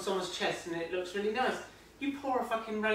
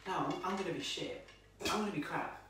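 inner critic; 7 dB quieter than usual. Retold or imitated. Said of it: "in the back of your head".

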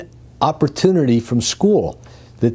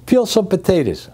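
barked kitchen order; 8 semitones higher than usual. Retold or imitated. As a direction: order said higher and clipped, like a boss. Boss giving a kitchen order.

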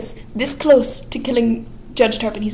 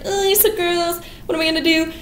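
put-on higher, whiny girlish complaint; whiny disgust; 8 semitones higher than usual. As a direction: squeal it up high in disgust. Joanna disgusted by the proposal.

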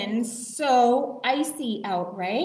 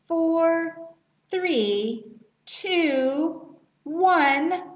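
countdown building louder; new year countdown crowd; 4 semitones higher, 4 dB louder, much slower than usual. Shown as a demonstration. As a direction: count down, rising toward midnight. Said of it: "Ten fingers".

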